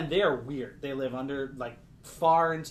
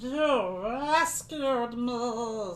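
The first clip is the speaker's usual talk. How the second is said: very slow, higher otherworldly voice; slow eerie head voice; 9 semitones higher, much slower than usual. Acted. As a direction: drift into his mind slowly. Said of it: "says his voice in your head".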